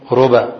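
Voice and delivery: "ربا" with the first letter said as ru and a light, unstressed ba; ruba pronunciation. Shown as a demonstration. Teacher shows students a variant, saying "ربا" with ru and a single b. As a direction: say "ربا" as ru-ba with a short, undoubled b. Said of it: "ضم الراء وتخفيف الباء".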